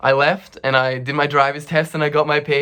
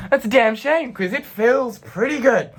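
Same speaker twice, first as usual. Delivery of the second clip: high, sing-out mock sympathy; hammed-up high-pitched comeback; cheeky retort; 11 semitones higher, 3 dB louder than usual. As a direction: toss it back gleefully unbothered. Acted cheeky retort to complaint.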